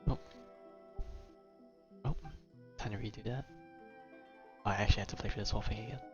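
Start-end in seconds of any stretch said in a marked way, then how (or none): none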